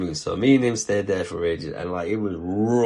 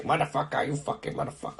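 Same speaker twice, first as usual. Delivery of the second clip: imitating old lady swearing; 6 semitones higher, 4 dB quieter than usual. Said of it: "she was a badass".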